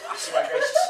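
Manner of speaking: very high comic squeak; squealed oath. Acted. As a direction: squeak the vow in falsetto. Devil squeals oath again.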